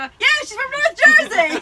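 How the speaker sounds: high, loud laughing jab; laughing punchline. Retold or imitated. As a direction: land the joke through a laugh.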